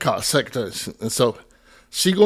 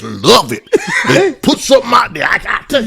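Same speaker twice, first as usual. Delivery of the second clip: laughing; 7 semitones higher, 4 dB louder than usual. Real, not acted. Laughing while telling gossip story.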